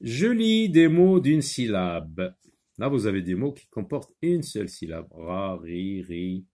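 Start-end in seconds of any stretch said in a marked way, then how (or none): 0.1-2.4 slow, high, loud teacher announcement; reading out a heading. Shown as a demonstration. Teacher announces the exercise to pupils, slow and projected. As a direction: read the title out deliberately. Reads the exercise title aloud.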